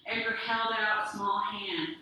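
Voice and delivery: ordinary delivery, nothing marked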